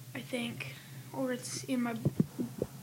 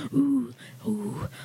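breathy monkey hoots; hooting giggly monkey; 4 dB louder, slower than usual. Pretend. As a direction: hoot like a monkey, half whispered. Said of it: "You sound like a monkey in heat".

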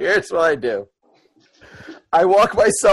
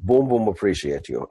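low, softer orc voice; gentle lumbering orc, pitched down; Boom Boom; 9 semitones lower, 6 dB quieter than usual. Acted. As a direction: thank them low and simply. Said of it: "and I go".

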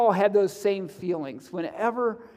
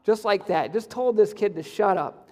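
usual speaking voice

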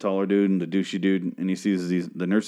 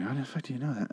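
hushed aside; 4 semitones higher, 12 dB quieter, faster than usual. Retold or imitated. Retold own disbelieving aside.

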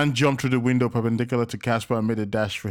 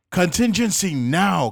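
loud, slow commanding shout; yelled order; 8 semitones higher, 5 dB louder, slower than usual. A narrator's reading. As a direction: order the escape at once. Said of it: "Casper yelled".

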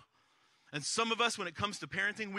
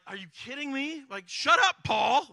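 high, loud, incredulous mock outburst; mock outrage; 9 semitones higher, 9 dB louder than usual. Reader talking back to Paul after reading him, mock outraged. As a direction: build from disbelief to a comic yell.

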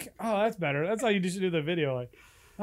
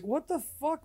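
high baffled what the fuck; incredulous; 9 semitones higher than usual. Retold or imitated. Mocking his own baffled reaction to ear hair, pitched up high. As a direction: squeal it in disbelief.

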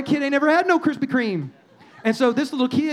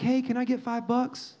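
softer, offhand little ask; small request; 7 dB quieter than usual. Retold or imitated. Son asking dad for five bucks, small and quiet. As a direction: drop the volume for a minor favor. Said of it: "That's a little, you know".

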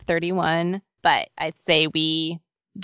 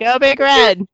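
cheering; 4 semitones higher, 8 dB louder, slower than usual. Pretend. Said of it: "then you guys say "Go Big Red".

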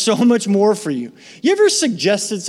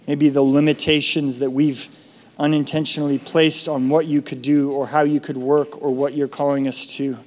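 prayer; 3 semitones lower than usual. Acted. Closing prayer.

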